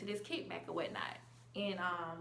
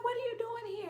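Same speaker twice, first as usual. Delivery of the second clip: surprised high question. Retold or imitated.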